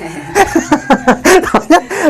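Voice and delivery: high chuckling laugh; real amused giggle; burst of laughter. Real, not acted. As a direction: laugh at your own demonstration. Laughs at own drawn-out syllable.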